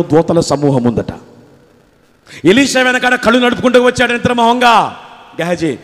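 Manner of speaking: ordinary talk, nothing marked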